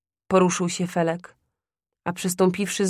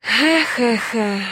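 przymilny chichot he he he, wyżej; słodki śmieszek; 4 semitones higher, 4 dB louder, much slower than usual. Acted. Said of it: "rozśmiał się słodziej jeszcze". (przymilny śmieszek kupca wobec sąsiada)

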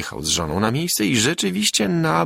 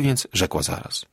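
plain reading voice

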